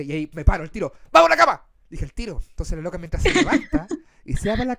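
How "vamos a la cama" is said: high, loud call to bed; acted call. Man in his story calling his date to bed, acted call.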